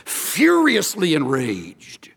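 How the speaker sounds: furiously enraged said slowly, leaning on furiously; stressed phrase. Shown as a demonstration. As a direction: weigh each word of furiously enraged. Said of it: "those are strong words in the Greek".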